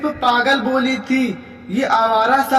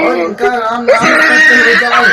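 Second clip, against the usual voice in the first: loud higher voice, laughing through the curse; gleeful cursing laugh; 4 semitones higher, 7 dB louder than usual. Acted. Bahu to mother-in-law, loud laughing curse. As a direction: cackle out the wish with glee.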